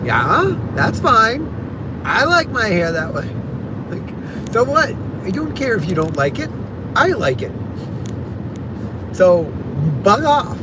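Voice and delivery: retelling how she told her cousins to bug off; defiant retort. Retold or imitated. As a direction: toss it back at them, unbothered. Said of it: "And I'm like".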